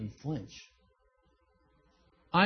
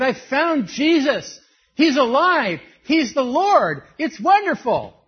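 much higher and louder, slow and beaming; excited new believer; 9 semitones higher, 10 dB louder than usual. Retold or imitated. Young convert telling friends about Jesus, thrilled and loud. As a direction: burst out the good news. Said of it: "I was so excited".

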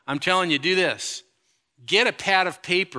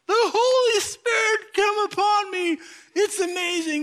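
much higher hammed-up rapture voice; mock ecstatic believer; 3 dB louder than usual. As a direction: parody a swooning zealot. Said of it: "and just go".

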